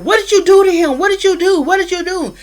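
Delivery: high, loud accusing cry; confronting them, voice up and loud; retold angry demand. Bella confronting Sam and the pack, high angry shout.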